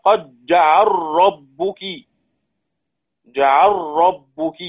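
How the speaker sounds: جعل ربك slow, the L of جعل merged into a doubled R, "jaʿarrabbuk"; slow Quran recitation merging the L into the R. Shown as a demonstration. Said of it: "هذه مفتوحة وقبلها متحرك".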